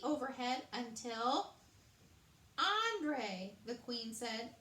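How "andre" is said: calling out. Acted. Queen calls the chef.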